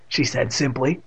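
ordinary delivery, nothing marked

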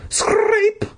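sudden very high squeak. Acted. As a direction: jump way up on the word. Narrator mimicking scraping sound.

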